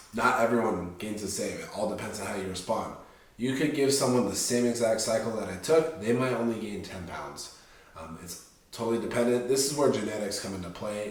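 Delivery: ordinary talk, nothing marked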